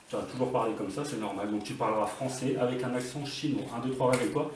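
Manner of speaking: low, soft hypnotist voice; hushed, lulling stage hypnosis; hypnotic suggestion. Pretend. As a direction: make it sound perfectly normal, very quietly.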